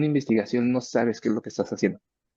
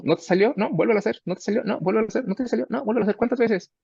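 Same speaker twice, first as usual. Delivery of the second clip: much higher, sing-song nagging loop; repeated retry drill; 11 semitones higher, faster than usual. Retold or imitated. Teacher mimicking the endless retry loop, much higher sing-song. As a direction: rattle it off like a tireless drill sergeant of retries.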